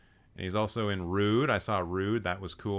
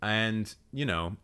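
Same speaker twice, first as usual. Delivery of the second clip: ordinary talk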